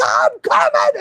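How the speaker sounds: high shrill rescuer cry; heroic shout. Retold or imitated. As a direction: call out like a rescuer charging in. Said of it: "she was like".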